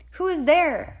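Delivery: loud, high call. Acted. Rosebud calls to the tapping again, loud and high. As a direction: demand who's there.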